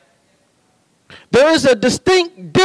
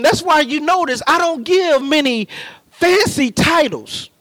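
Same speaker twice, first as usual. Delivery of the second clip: ordinary talk, nothing marked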